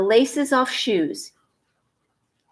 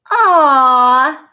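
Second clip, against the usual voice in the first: tender aww; 4 semitones higher, 7 dB louder than usual. A narrator's reading. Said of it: "said Mommy".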